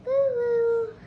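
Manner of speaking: a rat's pretend wail; little rat crying for the story; mock crying boo-hoo. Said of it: "who cried".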